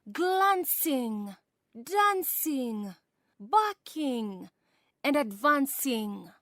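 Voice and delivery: rhythmic, lilting poetry reading; reading a poem aloud to a class with a swinging rhythm; poem recitation. Acted. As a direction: recite it with the beat of the dance. Teacher recites poem Tarantella.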